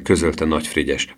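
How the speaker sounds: usual speaking voice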